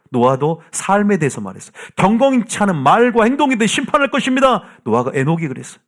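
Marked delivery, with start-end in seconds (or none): 2.0-4.6 high, forceful warning of judgment; prophetic proclamation. Acted. Enoch warning the ungodly of judgment, in forceful proclamation. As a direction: proclaim it like a prophet, pitch raised throughout.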